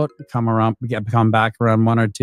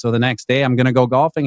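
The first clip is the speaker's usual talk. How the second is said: ordinary talk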